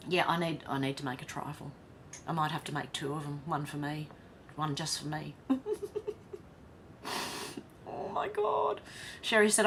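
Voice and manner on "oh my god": high squeal through laughter; laughing oh my God. Real, not acted. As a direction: giggle it out. Laughing reaction.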